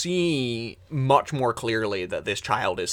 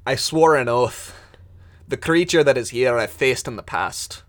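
loud, higher, pained declaration; the digger loudly refuses to help; agitated refusal; 5 semitones higher, 13 dB louder than usual. Acted. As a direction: shout the refusal at the child.

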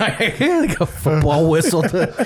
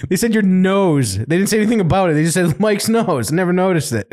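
ordinary talk

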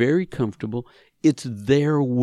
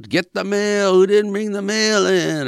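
higher, louder hammed-up yell; mock yell; 8 semitones higher, 4 dB louder than usual. Retelling a family member yelling over the mail, mock yell. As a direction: imitate an angry parent barking about the mail. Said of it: "you're yelling".

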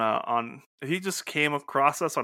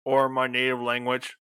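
level machine monotone; robot voice. Pretend. Robot host naming its native language, monotone. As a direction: rattle binary out evenly. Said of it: "robot voice".

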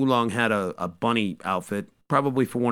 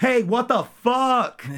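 high, slow, loud yell; drawn-out indignant shout; Bulma yelling; 13 semitones higher, 4 dB louder, much slower than usual. Retold or imitated. Bulma popping out of the mobile home at Yamcha, high slow yell.

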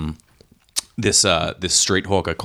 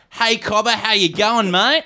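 imitating a hawker's high-pitched, 1950s-style Australian accent; old-fashioned Australian accent; 13 semitones higher, slower than usual. Thai hawker greeting an Australian tourist in an old-fashioned, high Australian accent. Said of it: "pulled out the best Australian accent I've ever heard".

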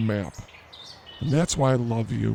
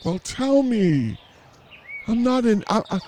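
exasperated; 9 semitones higher than usual. Real, not acted. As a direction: drag it out in disbelief. Demanding answer from co-host.

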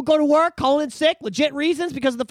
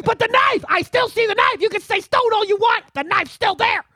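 high, loud, repeating the knife; mock insistent shout; 10 semitones higher, 4 dB louder than usual. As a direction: hammer the point home.

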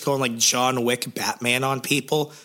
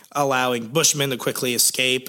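ordinary talk, nothing marked

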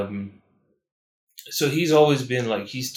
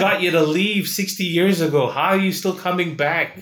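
mock exasperated rebuke; 5 semitones higher, 4 dB louder than usual. Acted rebuke of returning missionary.

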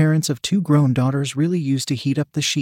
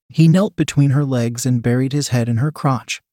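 usual speaking voice

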